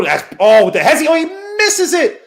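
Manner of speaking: high, loud rising call; excited play-by-play. Real, not acted.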